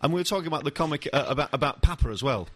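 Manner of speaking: ordinary delivery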